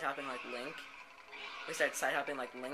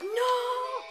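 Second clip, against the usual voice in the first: high, long melodramatic scream; hammed-up cry of despair at full volume; anguished "no"; 11 dB louder than usual. Acted loss of powers.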